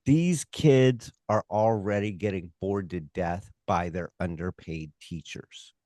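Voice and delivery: normal talking voice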